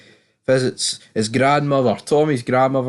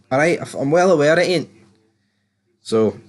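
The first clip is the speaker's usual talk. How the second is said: ordinary talk, nothing marked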